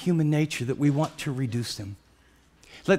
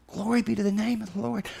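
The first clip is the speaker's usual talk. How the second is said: softer, reverent exclamation of praise; hushed praise; 5 semitones higher, 5 dB quieter than usual. Real, not acted. Preacher praises the Lord after his climax, hushed reverence. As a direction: drop to a quiet, worshipful tone.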